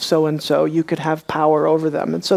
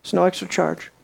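normal talking voice

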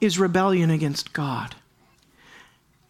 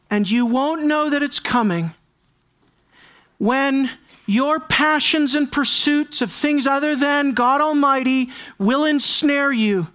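raised, ringing preacher voice building to a cry; preaching at full force; 7 semitones higher, 3 dB louder than usual. Acted. Preacher warning the congregation, loud and soaring. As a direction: drive the warning home to the pews.

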